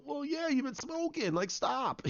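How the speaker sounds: high-pitched exasperated retort; mock exasperation. Acted exasperated retort in retelling.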